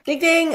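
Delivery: high, loud sing-song ding ding like a quiz bell; bell ding imitation. Host marks a correct answer with a high bell imitation. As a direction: ring it out like a game-show bell.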